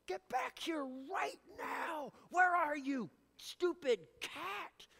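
high, slow mock yell at the cat; harsh call to cat. Preacher mimicking a harsh shout at his runaway cat.

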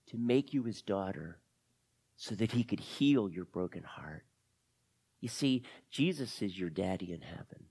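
soft, earnest comforting; tender reassurance. Acted. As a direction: gently assure a hurting child of love. Lizzie consoles Camila with faith.

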